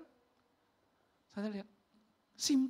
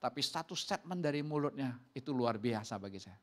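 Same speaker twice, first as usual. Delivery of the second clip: ordinary talk, nothing marked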